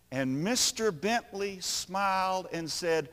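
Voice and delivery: normal talking voice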